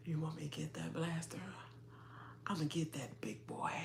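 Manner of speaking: hushed mock voice, soft and breathy; imitating a whisper. Host mimics a contestant murmuring his line, in a soft whisper. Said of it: "He was like".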